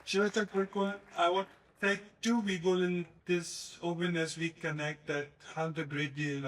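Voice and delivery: ordinary talk, nothing marked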